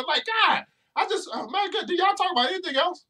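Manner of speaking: a tired, incredulous plea at the end; exasperated disbelief. Real, not acted. Fed up with endless relationship talk.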